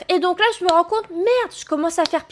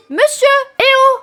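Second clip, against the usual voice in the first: high raised hail; calling out; 9 semitones higher, 6 dB louder than usual. Retold or imitated. Streamer hailing the conductor again, raised. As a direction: call the conductor loudly. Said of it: "je lui dis".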